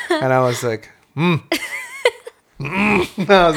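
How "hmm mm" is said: frustrated wordless grunt; dad grunt. Retold or imitated. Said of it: "that's that dad grunt".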